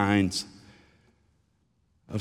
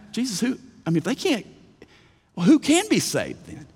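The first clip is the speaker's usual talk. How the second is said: high-pitched worried asking; alarmed question; 10 semitones higher than usual. Retold or imitated. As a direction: voice the panicked disciples asking. Disciples' alarmed question retold.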